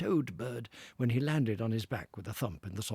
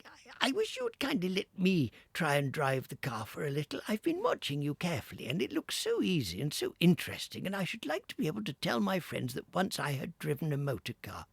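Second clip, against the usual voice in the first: Toad as washerwoman; 7 semitones higher than usual. Acted. Toad asks to drive.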